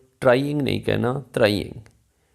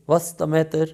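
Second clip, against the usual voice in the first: quickly spoken; 6 semitones higher, much slower than usual. Shown as a demonstration. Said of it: "तो तेजी से बोलते हुए".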